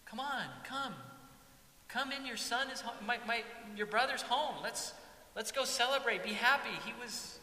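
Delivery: high, eager coaxing; pleading father. Acted. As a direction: coax him warmly to come celebrate. Father pleads with older son.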